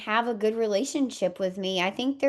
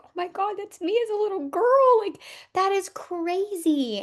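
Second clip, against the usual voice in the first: much higher and louder, astonished; amazed exclamation; 11 semitones higher, 3 dB louder than usual. Retold or imitated. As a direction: gasp it out in disbelief. Seeing herself as little girl.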